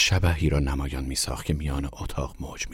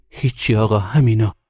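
louder, higher, lightly acted flustered servant; flustered, frightened; 5 semitones higher, 8 dB louder than usual. A narrator's reading. Frightened servant showing the boss his pockets, flustered. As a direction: blurt it out high and loud, mildly panicked.

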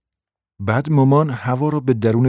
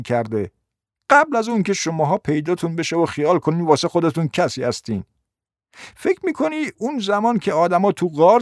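high-pitched, indignant rant; scoffing grandmother; 7 semitones higher than usual. Acted. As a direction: rattle off a grievance in a high character voice. Grandmother rants at mother.

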